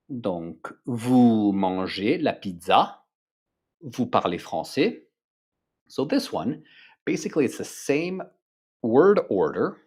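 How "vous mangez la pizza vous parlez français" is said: statement turned question by a rising tune at the end; question intonation. Shown as a demonstration. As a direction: let each sentence rise at the end to ask. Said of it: "but with an intonation".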